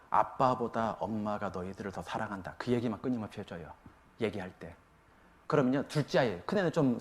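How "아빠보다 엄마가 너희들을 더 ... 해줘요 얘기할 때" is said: soft tender. Real, not acted. Telling children tenderly.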